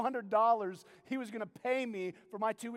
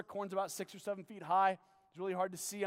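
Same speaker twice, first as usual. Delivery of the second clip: normal talking voice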